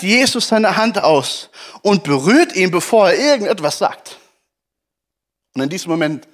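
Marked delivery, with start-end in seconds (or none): none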